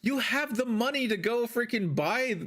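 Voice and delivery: high, exasperated retort; a lightly voiced scolding; mock scolding. Mocking the crying guy, high exasperated scolding. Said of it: "it's like".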